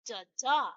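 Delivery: weak first "da", strong second "da"; iambic stress pattern. Shown as a demonstration. Teacher showing students how an iamb sounds, stress on the second "da". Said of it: "how does it sound?".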